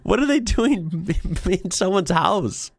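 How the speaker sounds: high, slow disbelieving question; can't believe what she's reading, voice up high; incredulous. Real, not acted. Reacting to tourists in house.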